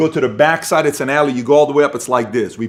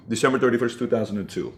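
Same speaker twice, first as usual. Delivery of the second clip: softer, fading repeat of the date; quiet echo; 3 semitones lower, 7 dB quieter, faster than usual. Shown as a demonstration. Repeating the date of his breaking point, fading to quiet. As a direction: let the date sink in almost under breath. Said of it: "I'll give you the exact date".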